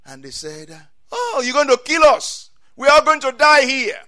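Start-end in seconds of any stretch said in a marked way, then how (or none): 1.1-4.0 loud, high angry complaint; mad outburst. Acted. The people turning on God, mad outburst. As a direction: wail out an angry accusation. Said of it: "got so mad with God".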